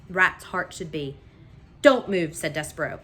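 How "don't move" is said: firm warning. A narrator's reading. Mouse threatening rat.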